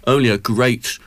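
ordinary talk

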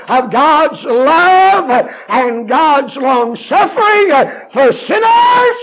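high, loud, drawn out; preaching at full force. Acted. Preacher proclaiming God's judgment and love at full force. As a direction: pour it all out.

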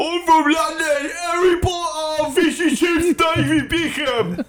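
very high, squawked fake London accent; hammed-up mock-English yelp; bad English accent. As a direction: list English clichés in a silly high accent. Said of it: "your English accent".

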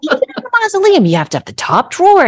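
high, exasperated rant at a snobby father; mock yelling at dad. Daughter venting at her late dad, high exasperated yelling. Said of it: "like, yelling at him, literally in my head".

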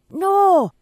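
sudden loud, high no; alarmed shout. Acted. Groom lunging at brother to stop the phone search, shouting. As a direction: stop him with a cry.

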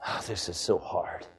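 low, soft mocking murmur; mock grumbling. Pastor mimics grumbling churchgoers, low soft mock complaint. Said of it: "murmur against the Lord and say".

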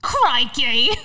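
loud, put-on Australian exclamation; Australian accent. Shown as a demonstration. Co-host asked to show off Australian accent, mock Aussie. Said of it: "Let me hear your Australian accent".

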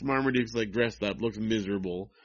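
ordinary talk, nothing marked

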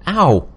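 sudden loud, high cry of pain. Acted. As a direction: yelp as the muscle clenches. Leg jerks in pain.

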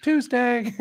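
high, airy one-word answer; high breezy wife. Retold or imitated. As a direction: toss it off lightly, high. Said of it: "She's like".

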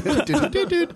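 high, short toots; imitated car horn. Podcaster imitating Herbie's horn for co-hosts, high toots. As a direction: toot like a little cartoon car. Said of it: "I can't remember what it sounded like".

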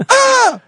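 a short, very high, loud yelp of alarm; mock scream. Driver retelling how the coach screamed at the fire: high, loud mock scream. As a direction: shriek it high as if the coach just spotted the fire. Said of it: "did you scream like that".

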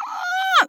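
short high-pitched "ah" scream; mock scream. Host reacting to the study's finding, mock scream. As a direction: let out a high, brief scream.

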